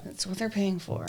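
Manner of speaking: soft muttered repeat; quiet echo. Real, not acted. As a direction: murmur it again under the breath.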